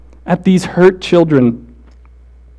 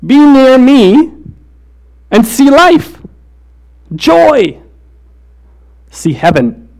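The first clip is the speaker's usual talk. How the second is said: Jesus voice; 5 semitones higher than usual. Retold or imitated. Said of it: "his response is".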